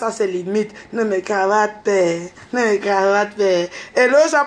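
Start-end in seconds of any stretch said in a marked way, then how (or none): 0.9-1.9 lightly voiced gentle man pleading, "Ne me cravate" said evenly; a hint of a sweet-talking man; mock soft voice. Mocking the man who begged her, in a soft voice. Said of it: "Tu fais la voix douce avec moi". 1.9-3.4 "Ne me cravate" stretched out very slowly, each word lingered on; slow, syrupy imitation of a pleading man; drawn-out mock soft voice. Mocking the man who begged her, slow and drawn-out. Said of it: "Tu fais la voix douce avec moi".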